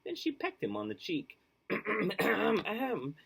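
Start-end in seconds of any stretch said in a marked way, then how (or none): 1.7-3.1 high, mock throat-clearing ahem; coughed ahem. Principal clearing his throat before a speech, mock ahem. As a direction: clear the throat for attention, playfully. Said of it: "coughed Mister Lowe".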